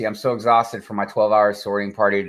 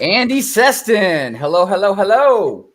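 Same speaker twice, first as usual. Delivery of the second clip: cheery greeting; 7 semitones higher, 6 dB louder than usual. Real, not acted. Greeting chat viewer loudly.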